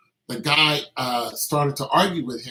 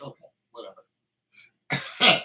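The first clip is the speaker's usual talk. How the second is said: dismissive; 3 dB louder, much slower than usual. Retold or imitated. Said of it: "I was like".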